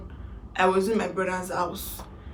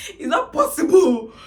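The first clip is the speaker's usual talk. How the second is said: laughing; 7 semitones higher, 3 dB louder than usual. Real, not acted. Laughing at an absurd claim.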